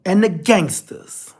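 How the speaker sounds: ordinary talk, nothing marked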